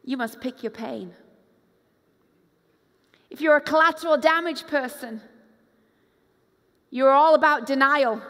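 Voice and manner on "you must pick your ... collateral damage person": ordinary talk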